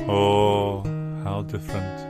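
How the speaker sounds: ordinary talk, nothing marked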